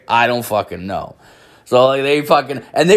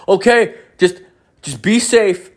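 high, louder voice for polite visitors; concerned missionaries; 9 semitones higher, 4 dB louder than usual. Retold or imitated. Missionaries parting from a drunk man, high-voiced concern. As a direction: lift the voice high, politely worried.

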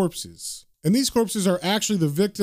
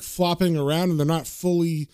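plain reading voice

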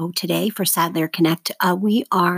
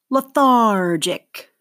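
"Lethargic" very slowly, THAR stretched; stress on second syllable; 2 semitones higher, 4 dB louder, much slower than usual. Shown as a demonstration. Teacher models for seventh graders to mark, slow stressed THAR in "Lethargic". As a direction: say "Lethargic" as le-THAR-gic, leaning long on THAR. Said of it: "circle the stress syllable".

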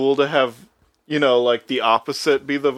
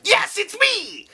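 very high, drawn-out gremlin cry; shrieky little creature voice; John Adams; slower than usual. Retold or imitated. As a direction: squeal it slowly, like a delighted goblin. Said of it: "John Adams' voice is so good".

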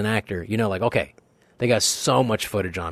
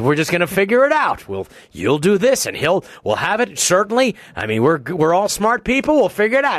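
higher, louder anxious reassurance; smooth things over nervously; worried producer; 9 semitones higher, 5 dB louder than usual. Retold or imitated. Producer trying to keep the set calm, anxious reassurance.

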